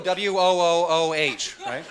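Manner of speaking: ordinary delivery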